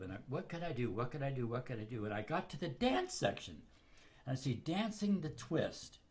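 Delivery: ordinary talk, nothing marked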